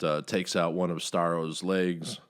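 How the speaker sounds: ordinary talk, nothing marked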